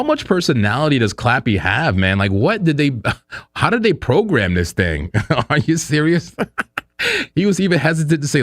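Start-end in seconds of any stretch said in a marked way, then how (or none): 6.4-7.3 squealing high laugh; high laughing fit. Real, not acted. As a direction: let a high laugh break out.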